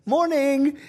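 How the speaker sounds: put-on high, small child's one-word answer; a little boy answering the magician; little boy's voice. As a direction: pipe it up like a shy first grader. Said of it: "this little boy on the front row goes".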